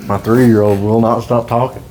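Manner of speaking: laughing while telling it; amused parent chuckling through the line. Real, not acted. Laughing about his toddler.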